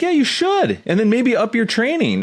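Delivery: high, bright yes, you should; excited encouragement. Retold or imitated. Coach cheering a student toward a tournament, excited. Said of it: "more excited for me".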